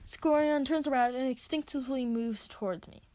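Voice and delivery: ordinary delivery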